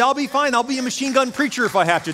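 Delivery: high-pitched imitation of fearless bravado; confident. Missionary assuring his pastor he will be fine, confident. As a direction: lift the pitch, brush off the danger. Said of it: "this guy is so confident".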